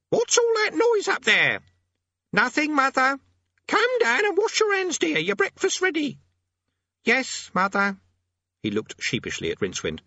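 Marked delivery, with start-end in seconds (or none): 0.1-1.6 very high, loud, slow scolding call; mother yelling upstairs. Acted. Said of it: "She's got a good pair of lungs". 2.3-3.2 high, loud innocent reply; boy calling back. Acted. Boy calling down to his mother. 3.7-6.2 mother yelling upstairs. Acted. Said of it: "She's got a good pair of lungs". 7.0-8.0 put-on high boy's voice calling back; obedient son. Boy obediently calling to his mother.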